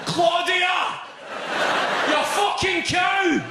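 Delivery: put-on high, strained drunken shouting; steaming drunk, wailing accusations; drunken raging yell. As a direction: yell at your ex in the street, very high and drawn out. Acts out steaming drunk man after vote.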